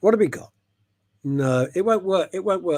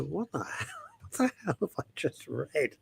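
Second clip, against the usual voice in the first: baffled whisper; 10 dB quieter than usual. Retold or imitated. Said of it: "I go".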